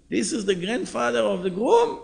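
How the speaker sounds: shocked disbelief. Retold or imitated. Driver seeing his passenger at the table, stunned. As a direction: gasp it out in disbelief. Driver recognizes the old man.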